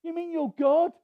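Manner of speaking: high, loud incredulous questions; can't believe it's God; stunned disbelief; astonished. Retold or imitated. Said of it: "The Lord said".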